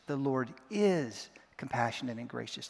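"is" stretched out and set apart; stress on IS. Shown as a demonstration. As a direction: lean hard on "is", then pause briefly. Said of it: "putting emphasis on one word at a time".

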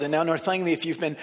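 ordinary talk, nothing marked

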